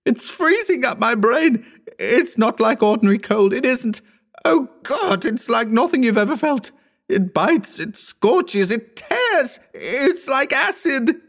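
high, frantic shrieking; very high-pitched tormented outburst; delirious agony. A narrator's reading. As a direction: wail as if the pain is driving you mad. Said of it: "in a delirium of agony".

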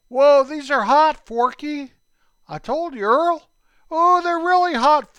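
high, loud cry of a burning tongue; burning-mouth yelp. Acted. Earl to Forky, yelping at the burning heat. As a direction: yelp as the cinnamon bites.

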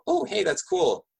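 light, high exclamation to oneself; pleasantly surprised. Retold or imitated. Recalling a first thought on meeting a German, pleasantly surprised.

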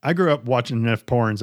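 usual speaking voice

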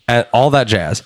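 ordinary delivery

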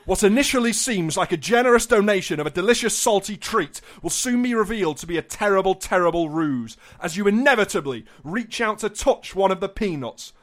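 grand, rising storyteller delivery; theatrical relish on every villainous detail; mock-dramatic narration. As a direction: declaim it like a dire warning. Reads scam description dramatically.

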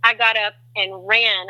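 ordinary talk, nothing marked

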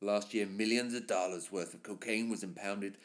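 reading the script as the narrator Brandon. Acted. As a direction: read it low and weighty like a cop voiceover. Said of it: "perform this as Brandon".

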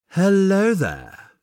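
slow, soothing greeting from the dark. Acted. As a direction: greet him calmly to put him at ease. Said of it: "a soothing voice calls out".

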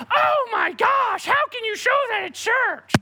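high shrieking teen voices, hammed-up outrage; mock shocked teens. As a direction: squeal it like scandalized teenagers. Teens mocking a church commercial.

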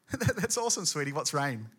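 mock-supportive sweetie voice; humouring wife. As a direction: gush first, then ask cluelessly. Wife humours Noah a third time.

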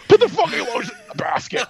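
hammed-up high, loud movie-killer yell; mock exasperated scream. Fed-up shopper after long price checks, mock screaming outburst. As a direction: burst out high and loud, imitating an enraged killer. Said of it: "Rob's just like".

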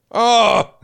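loud, high "oh" of sudden realisation; dawning realisation. Retold or imitated. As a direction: act out the penny dropping, loudly. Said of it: "that realisation".

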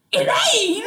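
high-pitched mock-incredulous cry of a name. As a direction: call her out in disbelief, high and half laughing.

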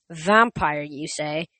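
usual speaking voice

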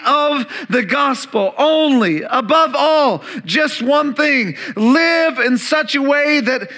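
a preacher lifting his voice on the key point; preaching at full force. Acted. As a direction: drive home just one thing, slowly.

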